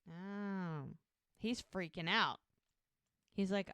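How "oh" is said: plain reading voice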